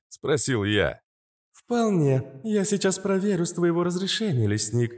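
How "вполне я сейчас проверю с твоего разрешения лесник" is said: higher, even machine-like AI; calm AI voice. A narrator's reading. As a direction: explain it like a calm AI. Astra answers.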